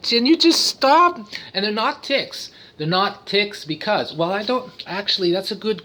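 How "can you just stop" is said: high, loud, drawn-out plea; mock whiny exasperation, dragging the words; imitated exasperated kids. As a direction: imitate kids who are fed up. Acts his exasperated kids.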